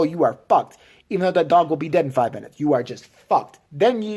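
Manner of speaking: ordinary talk, nothing marked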